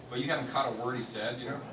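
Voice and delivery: normal talking voice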